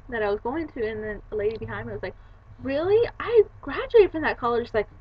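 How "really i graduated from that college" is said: higher, louder amazed voice; imitated surprise. Lady in line hearing of her own college, surprised. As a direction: re-enact an astonished bystander.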